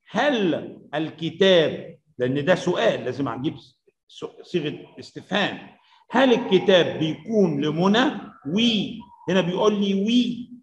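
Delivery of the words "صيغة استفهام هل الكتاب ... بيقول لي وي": normal talking voice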